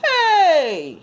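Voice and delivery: high, loud startled shout; surprised yell. Acted. Edue yelling when the puppy's nose touches him. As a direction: jump at the wet nose. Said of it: "yelled Edue".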